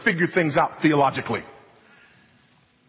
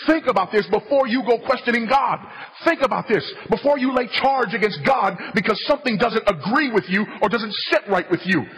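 fervent preaching; 6 semitones higher than usual. Real, not acted. Preacher warning his hearers not to question God, fervent. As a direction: drive it home to the congregation.

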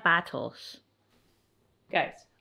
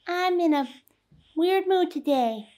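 voicing the baby; 10 semitones higher, 3 dB louder than usual.

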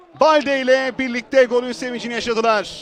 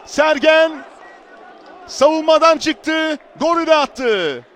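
ordinary delivery

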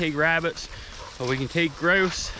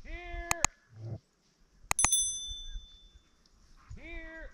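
calling a dog; 13 semitones higher, 14 dB quieter than usual. Real, not acted. Distant calls to dog.

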